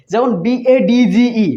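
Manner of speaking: ordinary delivery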